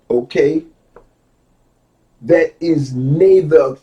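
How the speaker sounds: slow, loud, deliberate reading aloud; measured, emphatic quoting from a book. Real, not acted. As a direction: lay out each phrase with weight and long pauses. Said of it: "I'm emphasizing the words properly to you".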